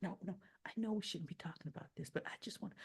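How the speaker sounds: hushed, fast conspiratorial whisper; secretive whisper. Retold or imitated. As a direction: lean in and whisper the secret quickly. Secretive plotting in a restaurant.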